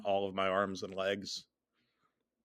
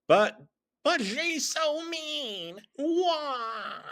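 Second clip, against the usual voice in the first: high, slow whining crybaby; whiny crybaby voice; much slower than usual. Acted. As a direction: whine like a crying kid.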